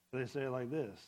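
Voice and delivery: now the other tone, letting it drop. Shown as a demonstration. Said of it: "you can't interpret tone".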